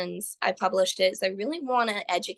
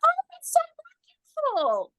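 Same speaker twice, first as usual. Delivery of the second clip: imitating a peppy nurse; faster than usual. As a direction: gush like a cheery cheerleader nurse. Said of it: "the nurse was just so excited".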